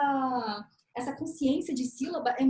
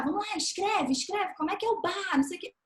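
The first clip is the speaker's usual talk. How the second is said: mock nagging; 6 semitones higher than usual. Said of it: "sem ficar".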